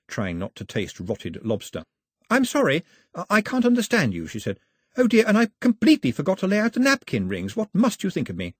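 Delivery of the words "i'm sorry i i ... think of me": put-on high, light young girl voice, anxious and polite; a young castaway girl fussing over her guest; girl's voice. A narrator's reading. Girl offering scones to her guest, high and eager.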